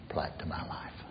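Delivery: reverent whisper. Real, not acted. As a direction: trail off softly in awe. Reverent close of thought.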